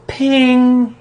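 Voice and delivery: high, ringing drawn-out ping; imitated ping. Explaining defects won't just vanish, imitated ping. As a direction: sound out a loud, high ping. Imitating an instant magical fix.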